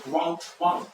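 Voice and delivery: normal talking voice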